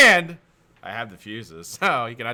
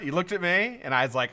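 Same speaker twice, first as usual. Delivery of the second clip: normal talking voice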